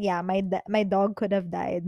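ordinary talk

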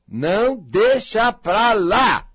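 slow, high and loud exasperation; exasperated. Acted. To viewers who hold grudges, slow exasperated cry. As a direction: drag it out in frustration.

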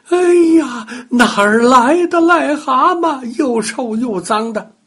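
high, loud disgusted shriek; startled cry. Acted. Grandma shrieking at the dirty toad at her feet. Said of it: "大叫起来".

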